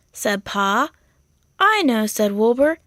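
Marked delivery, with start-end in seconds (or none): 1.6-2.1 put-on lifted, cheerful young lamb voice; chirpy and pleased with himself; a bright, proud little sheep. Wilbur again, cheerful.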